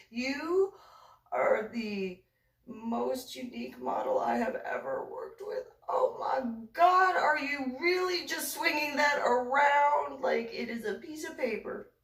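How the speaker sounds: high, drawn-out incredulous scolding; exasperated disbelief. Retold or imitated. Photographer aghast at model swinging a sledgehammer, exasperated. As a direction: act out an appalled colleague, dragging the key words.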